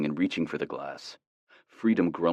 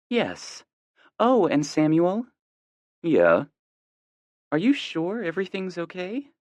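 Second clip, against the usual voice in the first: higher, careful mom voice; a mom checking once more; concerned mother; 12 semitones higher, 3 dB louder than usual. A narrator's reading.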